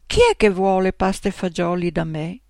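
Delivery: ordinary delivery, nothing marked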